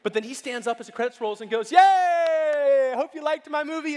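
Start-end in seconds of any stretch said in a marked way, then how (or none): 1.7-4.0 high, loud, gleeful yay; gleeful cheer. Retold or imitated. Friend cheering about his own movie, loud gleeful yay. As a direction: cheer like a proud kid at his own premiere.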